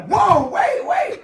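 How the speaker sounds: high, loud startled yelp; mock alarm, yelping whoa wait; startled shout. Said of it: "your face was just like".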